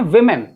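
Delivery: विमेन said "VIM-en", first vowel short i; correct "women" pronunciation. Shown as a demonstration. Teacher showing students the plural, pronounced "VIM-en". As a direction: say विमेन with vi, not vo. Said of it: "इसको हम लोग क्या पढ़ते हैं".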